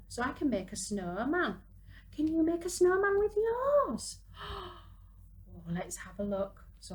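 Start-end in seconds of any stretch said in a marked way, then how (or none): 2.1-4.2 put-on high, louder, child-friendly question; excited question. Asking watching children excitedly. 4.2-4.9 delighted gasp. Acted. Excited at the snowman.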